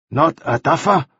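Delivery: sly sideways question. A narrator's reading. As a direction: ask it knowingly. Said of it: "said Ah Kui slyly".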